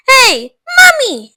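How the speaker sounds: high, loud, slow demanding call; shouted. Acted. Spoilt girl calls her mother, loud shout. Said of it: "shouted Veruca Salt suddenly".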